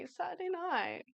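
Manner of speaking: normal talking voice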